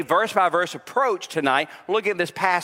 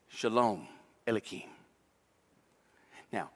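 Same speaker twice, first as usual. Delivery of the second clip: tender hushed preaching; 7 semitones lower, 5 dB quieter than usual. Acted. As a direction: draw the congregation in close and gently. Tender preaching on Jesus' peace.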